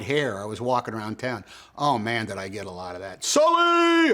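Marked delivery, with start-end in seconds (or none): 3.2-4.1 high shouted name across the street; screamed name. Retold or imitated. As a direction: yell it like an excited fan. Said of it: "the Sully screamed at me".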